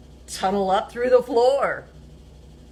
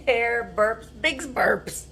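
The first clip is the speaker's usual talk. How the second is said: ordinary delivery, nothing marked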